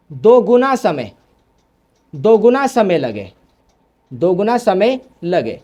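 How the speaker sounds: measured phrases with pauses for note-takers; slow dictation. Real, not acted. Teacher repeating a definition for students, slow dictation.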